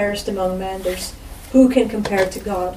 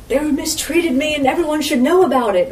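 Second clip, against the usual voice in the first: higher, louder mock grievance; mock self-pity; 6 semitones higher, 4 dB louder than usual. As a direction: parody a whiny complaint. Mocking a self-pitying complaint.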